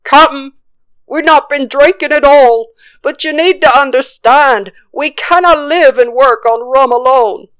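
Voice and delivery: high-pitched pleading pirate voice; fearful crewman. A narrator's reading. Fearful crewman explains himself to the captain, pleading. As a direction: plead your case in a high, strained pirate voice. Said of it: "One of the fearful gathered up the courage".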